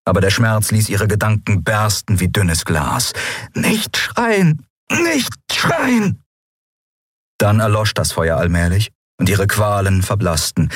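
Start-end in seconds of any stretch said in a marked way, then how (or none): 3.5-6.2 very high-pitched, strained cry; agonised desperation. Acted. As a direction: plead with yourself as pain overwhelms you. Selbstbefehl zerbricht unter der Folter. (qualvoll verzweifelt, sehr hohe Stimme)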